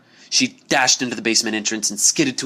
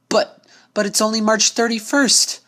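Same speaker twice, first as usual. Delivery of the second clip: higher, stammering objection; flustered protest; 12 semitones higher, 3 dB louder than usual. Acted. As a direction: sputter out the correction. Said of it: "Twilight Sparkle said".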